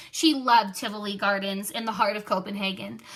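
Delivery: ordinary delivery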